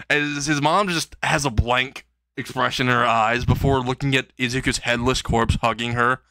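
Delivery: ordinary delivery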